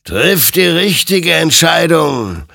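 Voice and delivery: loud, slow, high exhortation; creature's high, commanding warning; urgent admonishing. A narrator's reading. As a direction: admonish him loudly, stretching the words. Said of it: "ermahnte ihn sein Gegenüber".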